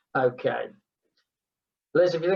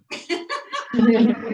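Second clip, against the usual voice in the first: laugh; 6 semitones higher, 3 dB louder than usual. Real, not acted. Laughing at the neighbours story.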